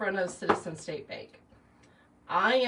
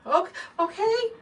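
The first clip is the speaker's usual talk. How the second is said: much higher, louder tearful okay; retold tearful panic; 13 semitones higher, 6 dB louder than usual. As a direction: gasp it out near tears. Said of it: "and I'm crying".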